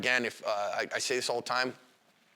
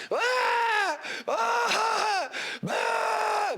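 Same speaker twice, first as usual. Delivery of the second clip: very high, loud pained screams; screaming in pain; 4 dB louder, much slower than usual. Retold or imitated. Suspect hit with OC spray, shrill pained screaming. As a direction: scream like your eyes are burning.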